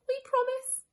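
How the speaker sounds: small chorus of mice; tiny mice voices. A narrator's reading. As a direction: mice promising meekly. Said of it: "said the mice".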